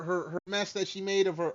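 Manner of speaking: usual speaking voice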